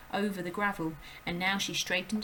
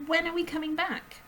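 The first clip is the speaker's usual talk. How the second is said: put-on high, urgent little girl's voice; a startled child calling out; high girl's cry; Matilda crying out; 10 semitones higher, 5 dB louder than usual. A narrator's reading. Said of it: "Matilda cried".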